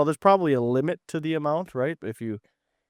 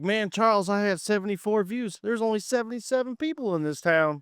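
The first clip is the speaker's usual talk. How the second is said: higher pitched impression of a rural buddy; imitating Charles; 7 semitones higher than usual. Teasing a friend by mimicking Charles's voice. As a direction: mimic Charles complaining about his views. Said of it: "Does he sound kinda like that?".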